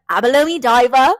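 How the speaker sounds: loud, hammed-up Aussie accent; Australian accent. Podcaster repeating a running joke, in loud mock Australian accent. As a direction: belt it out as an Australian impression. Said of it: "it was Australian guy".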